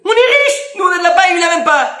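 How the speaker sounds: voix nettement plus haute et plus forte, fier de sa paille; rabbin vantard. Retold or imitated. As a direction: se vanter d'être riche, aigu et fort. Le rabbin voisin, voix jouée. (voix de rabbin vantard, bien plus aiguë et plus forte)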